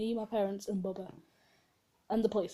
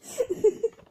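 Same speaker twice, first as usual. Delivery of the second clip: high squealing laugh; 8 dB louder than usual. Real, not acted. Laughing at own scream.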